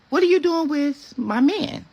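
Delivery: high, slow, challenging question; confrontational. Retold or imitated. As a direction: call her out, dragging it. Confronting the other woman.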